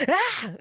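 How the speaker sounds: short, high scared yelp; a sudden frightened cry; child's shriek. Retold or imitated. A scared child shrieking at the church folk, a high yelp.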